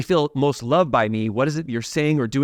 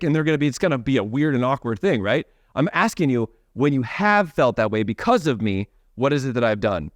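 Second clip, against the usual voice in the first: usual speaking voice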